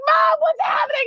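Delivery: put-on very high child's cry; alarmed child. Mother mimicking her daughter in backseat, alarmed cry. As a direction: imitate an anxious kid calling Mom.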